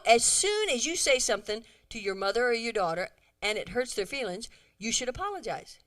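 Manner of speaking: ordinary talk, nothing marked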